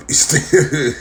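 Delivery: bursting into laughter on the name; laughing repeat. Real, not acted.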